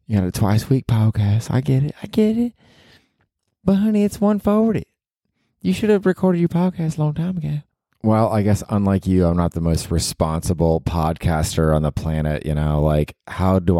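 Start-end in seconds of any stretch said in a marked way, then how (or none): 0.1-7.6 very high put-on Southern belle voice; Southern girlfriend. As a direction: do a falsetto Southern sweetheart. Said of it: "this is your girlfriend talking to you".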